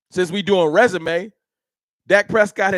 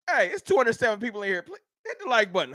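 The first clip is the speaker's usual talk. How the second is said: usual speaking voice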